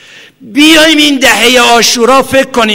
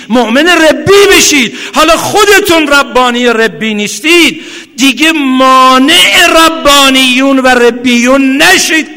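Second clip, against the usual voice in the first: loud, higher preaching at full force; impassioned cry; 5 semitones higher, 5 dB louder than usual. Retold or imitated. Preacher voicing Imam Hussein's cry to the crowd, loud and high. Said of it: "امام حسین داره فریاد میزنه".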